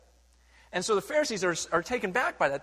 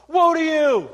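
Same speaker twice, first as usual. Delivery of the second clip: much higher, much louder "Woe to you!" yelled; mock road-rage curse; 11 semitones higher, 15 dB louder, slower than usual. Pastor joking about cursing a driver on the freeway, loud mock yell. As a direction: shout it at a driver who cut you off. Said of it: "it doesn't sound right".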